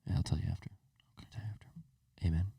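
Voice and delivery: softly whispered aside. Real, not acted. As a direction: whisper it like a secret. Secret aside at end.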